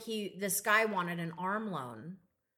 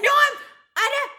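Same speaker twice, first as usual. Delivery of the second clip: high, loud panicked denial; whiny protest; 13 dB louder than usual. Retold or imitated. Employee protesting to his boss, high and loud. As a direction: plead that you didn't lie. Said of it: "He's like".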